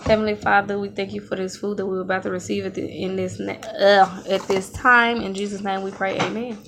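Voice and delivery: soft, quick mealtime prayer; saying grace. Real, not acted. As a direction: pray over the food, hushed. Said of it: "so let's say grace".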